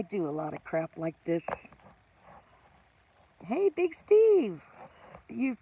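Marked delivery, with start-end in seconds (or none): 3.4-4.6 slow, loud, high call of "Hey, Big Steve"; mock loud greeting. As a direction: call out to Big Steve as if shouting back at his capitals. Answering a message in capitals.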